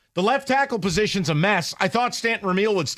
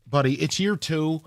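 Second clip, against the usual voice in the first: ordinary talk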